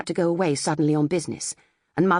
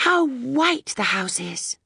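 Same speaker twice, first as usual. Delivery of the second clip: put-on higher, slow, wondering boy's voice; admiring boy; 6 semitones higher, slower than usual. A narrator's reading. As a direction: marvel at it like a child. Robert admiring the house.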